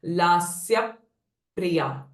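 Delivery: "Lasya priya" with lips pressed shut before pr; pressing effect. Shown as a demonstration. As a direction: close on the p after "Lasya", hold, then release "priya". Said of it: "the pressing effect before pronouncing the pr sound". ("Lasya priya" in two parts, pressed p between)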